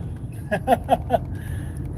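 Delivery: high breathy mocking chuckle; scornful laugh. Real, not acted. Mocking the terror line, a scornful laugh. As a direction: laugh in disbelief.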